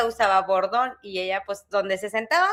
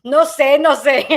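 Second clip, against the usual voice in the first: retold panic; 3 semitones higher, 10 dB louder, slower than usual. Child's panicked answer to grandma.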